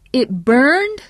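high, loud rising disbelief; incredulous. A narrator's reading. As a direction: ask it as if it cannot be true. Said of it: "Chuck's voice was incredulous".

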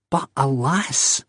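slow, helpless sigh of a line. Acted. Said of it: "he said helplessly".

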